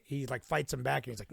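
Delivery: usual speaking voice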